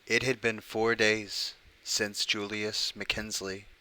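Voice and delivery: slow, measured story reading; story narration. Acted. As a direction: draw out the opening line slowly. Starts reading the creepypasta.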